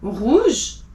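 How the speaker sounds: "rouge" with the r at the back of the throat; a throaty French r in "rouge". Shown as a demonstration. Teacher modelling the French r in "rouge". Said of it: "back at the throat".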